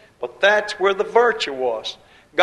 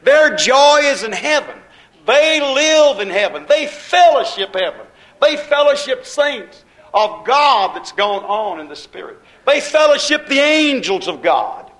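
raised, ringing preacher's cry, higher and louder; preaching at full force; 5 semitones higher, 5 dB louder than usual. Acted. Preacher exhorting the church, preaching at full force. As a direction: proclaim it to the congregation with fervour.

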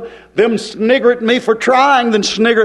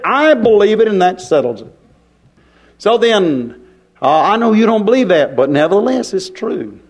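ordinary talk

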